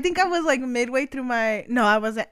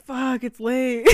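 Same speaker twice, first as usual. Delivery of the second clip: slow, drawn-out mock shout; mock dramatic yawn; 4 dB quieter, much slower than usual. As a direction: ham up the late-night sigh. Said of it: "And I'm like".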